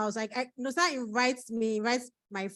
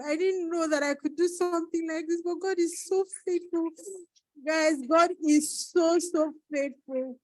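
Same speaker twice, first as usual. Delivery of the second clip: tearful; 7 semitones higher, 3 dB louder than usual. Real, not acted. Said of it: "don't put my video crying".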